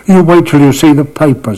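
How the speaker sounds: high, loud, imitated defiance; defiant promise. Young journalist to cricketer about the paper, defiant. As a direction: throw it out as a loud, high challenge.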